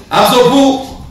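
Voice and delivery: exaggerated whiny excuse. The poor man repeats his excuse to the sheikh, hammed-up whine. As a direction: push the whine to a hammed-up plea.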